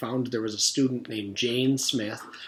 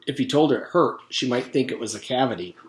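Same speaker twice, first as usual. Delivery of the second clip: plain reading voice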